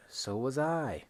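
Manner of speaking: "So was I" lifted up; "So was I" with an upward lift; rising "So was I". Shown as a demonstration. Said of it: "So positive, agree".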